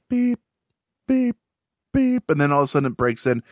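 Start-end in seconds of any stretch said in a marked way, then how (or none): 0.1-2.3 imitated beeps. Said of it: "when you hear, like".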